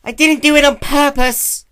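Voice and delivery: high, loud shrieking tantrum; filly hurling a bowl and screaming at Spike; furious scream. A narrator's reading. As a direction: scream it in a rage. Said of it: "Nyx's scream of".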